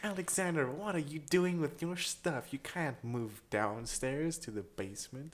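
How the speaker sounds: slow, drawn-out mock American parent; scolding parent. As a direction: play a parent telling off a teen. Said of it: "and then they're like".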